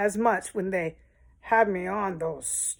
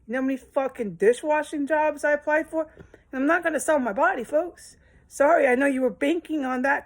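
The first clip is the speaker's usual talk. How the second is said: heated rant; 6 semitones higher, 4 dB louder than usual. Real, not acted. Angry rant about applying for jobs.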